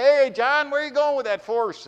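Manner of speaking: loud call up to the passing parishioner; yelling up. Acted. Pastor on his porch calling to John, loud. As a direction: shout it out to John on the road. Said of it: "he yells up and says".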